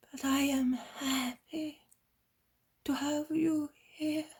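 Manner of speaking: high, weak, slow voice; barely able to speak; dying servant. Acted.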